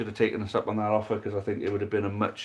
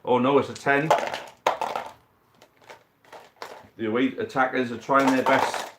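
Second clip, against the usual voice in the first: brisk sports commentator calling the match; live play-by-play. Acted. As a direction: call every roll like a match commentator. Live dice football match commentary.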